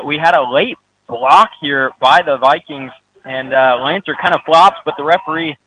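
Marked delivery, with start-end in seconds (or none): none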